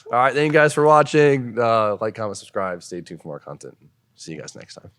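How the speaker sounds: host's outro, loud start settling down; polished closing spiel, fading at the end; practised sign-off. Real, not acted. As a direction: launch loudly into a video outro, then ease off. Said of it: "Damn, nice sign off".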